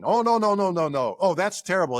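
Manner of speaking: mock dismay. Scholar objecting to Mark's Greek, mock dismay. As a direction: play a scandalized corrector, tumbling out the objection.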